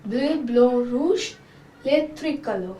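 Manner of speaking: bleu, blanc, rouge said bluh, blahn, roozh; French colours. Shown as a demonstration. Teacher naming the flag colours in French. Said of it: "and in French it's".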